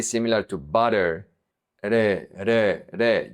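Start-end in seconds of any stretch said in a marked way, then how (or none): 1.8-3.3 re with a single quick tongue tap; tapped soft R. Shown as a demonstration. Teacher isolating the soft R, tapped re. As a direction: tap the r in re like the tt in American butter. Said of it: "you tap".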